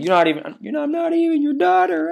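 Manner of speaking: high, loud, hurt shout of a retold fight; angry daughter's yell. Nami shouting at Bell Mare during their fight, high hurt yell. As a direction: fling it at her like a wounded teen.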